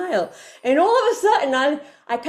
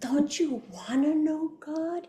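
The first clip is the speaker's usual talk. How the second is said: slow, higher, coaxing inner voice; soft little voice; 5 semitones higher, 4 dB quieter, slower than usual. Acted. Inner voice nudging her toward God, soft coaxing. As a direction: draw it out high and gently pleading. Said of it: "that little soft voice would say".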